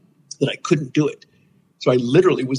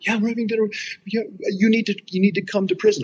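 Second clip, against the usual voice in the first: much higher, insistent urging; urgent friend; 9 semitones higher than usual. Retold or imitated. Friend in tears urging him to come to prison, higher-voiced. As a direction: push him hard to come.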